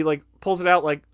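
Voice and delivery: ordinary talk, nothing marked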